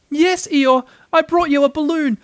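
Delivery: high, loud little voice; little Piglet, eager. A narrator's reading. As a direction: pipe up high with the good news.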